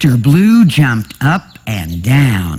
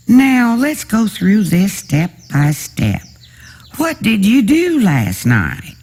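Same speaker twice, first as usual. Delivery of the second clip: higher, steady bedside voice; calm nurse; 8 semitones higher than usual. A narrator's reading. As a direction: take it step by step, gently.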